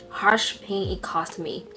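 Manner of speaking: usual speaking voice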